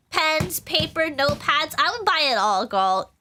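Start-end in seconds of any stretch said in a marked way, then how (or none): none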